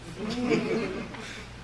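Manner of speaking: soft falling chuckle; held-back laugh. Real, not acted. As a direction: laugh while trying to calm it. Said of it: "I have to calm down my laugh".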